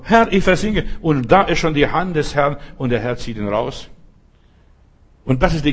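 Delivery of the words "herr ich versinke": higher, louder plea; a drowning man begging the Lord; pleading cry. Acted. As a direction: call out as the water takes you. Peter crying out sinking.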